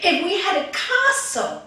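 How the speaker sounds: loud, high, swept-up excitement; acted wife carried away by grandeur; rapturous wishing. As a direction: dream out loud, building to ecstasy. Wife rapturously demands castle, queenship.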